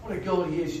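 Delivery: ordinary talk, nothing marked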